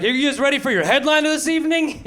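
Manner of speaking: high, forced hype host voice; fake-cheery emcee pumping the room. Failing opener forces hype for the headliner, high. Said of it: "he's like".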